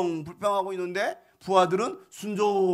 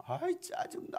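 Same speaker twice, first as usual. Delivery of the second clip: annoyed whispered grumble under the breath; grumbled whisper; 12 semitones higher, 8 dB quieter, slower than usual. Retold or imitated. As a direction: hiss it out, fed up. Naaman grumbling as he leaves.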